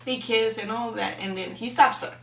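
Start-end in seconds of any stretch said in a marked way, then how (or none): none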